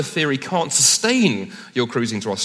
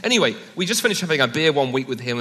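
ordinary talk

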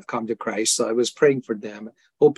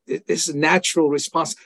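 ordinary talk, nothing marked

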